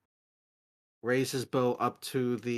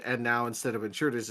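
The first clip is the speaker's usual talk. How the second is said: usual speaking voice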